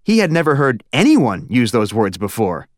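ordinary delivery

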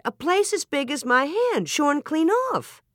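high, amazed insistence; excited, slowing onto the last words; interrupting, astonished. A narrator's reading. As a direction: cut in with high-pitched amazement. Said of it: "Ma interrupted".

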